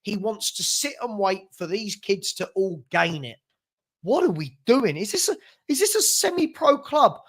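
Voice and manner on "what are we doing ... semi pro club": incredulous rising outrage; pitched-up exasperated question. Real, not acted. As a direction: throw the question out in disbelief. Exasperated rant at club.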